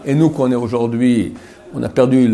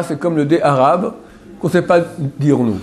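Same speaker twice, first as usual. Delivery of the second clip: normal talking voice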